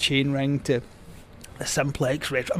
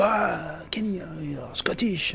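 soft, higher, mock French-accented journalist; Belgian-French accent; 4 semitones higher, 5 dB quieter, slower than usual. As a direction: imitate a Francophone reporter, hushed and accented. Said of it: "I'll stop the accent now".

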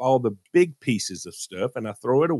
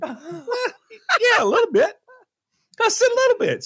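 laughing, higher, owning the sulk; laughing admission; 3 dB louder than usual. Retold or imitated. Husband admitting his attitude to wife, laughing.

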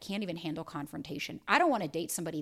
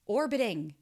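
ordinary delivery

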